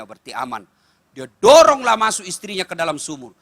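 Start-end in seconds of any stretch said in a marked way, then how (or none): 0.0-0.7 inner thought. Retold or imitated. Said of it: "Kata dalam hati suaminya".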